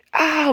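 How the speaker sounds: very high loud shriek; frightened scream. A narrator's reading. Muck terrified by the noise in the dark. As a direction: scream in terror. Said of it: "Muck screamed".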